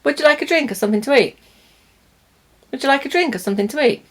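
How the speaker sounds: quick, run-together everyday English; natural connected speech. Shown as a demonstration. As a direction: rattle it off casually with weak forms. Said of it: "What it would sound like is".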